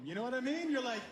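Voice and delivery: ordinary talk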